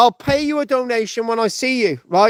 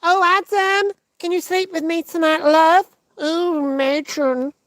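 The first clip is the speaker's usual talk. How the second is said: higher, breathy-free mock seductive Eve, slow on the sighs; Eve impression; 8 semitones higher, slower than usual. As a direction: swoon over Adam in a hammed-up female voice. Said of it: "I'm not gonna do fucking Eve".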